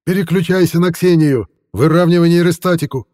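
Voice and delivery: loud, high urgent shout. Acted. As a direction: yell the orders over the noise. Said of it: "Едва различимый крик".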